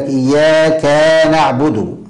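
إياك ending in a long "kaa" before نعبد; "iyyaa-kaa naʕbudu", extra alif after ك in إياك; overlengthened fatha on kaf. Shown as a demonstration. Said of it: "إشباع الفتحة في الكاف".

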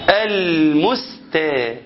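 very slow المستا with heavy emphatic sounds; thick t. Shown as a demonstration. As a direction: drag المستا with thickened consonants. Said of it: "التانية طلعت طاء".

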